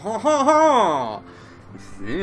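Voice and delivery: excited, high, laughing French vampire; French accent. Acted. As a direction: gush in French with delight. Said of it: "the French vampire".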